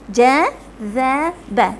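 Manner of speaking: teacher modelling letters one by one, slowly; slow, one syllable at a time. Shown as a demonstration. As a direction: draw each syllable out slowly. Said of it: "Satu-satu".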